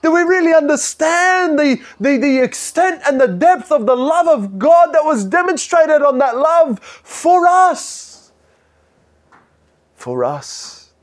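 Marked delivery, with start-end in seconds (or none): 0.0-8.3 high, loud preaching cry; soaring pulpit cry; fervent preaching. Acted. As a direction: preach it out at full force. Preaching at full force.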